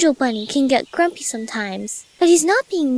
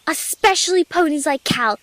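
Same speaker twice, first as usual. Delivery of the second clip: ordinary delivery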